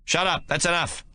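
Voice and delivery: sudden sharp sergeant's command; barked order. Acted. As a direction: silence the laughing platoon. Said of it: "Ben barks suddenly".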